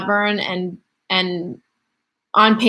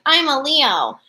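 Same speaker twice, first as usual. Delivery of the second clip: mock proud declaration, pitched up high; diehard Leo; 6 semitones higher, slower than usual. Streamer mimicking a diehard Leo fan, mock proud. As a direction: announce it like a die-hard zodiac fan. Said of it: "like, a diehard".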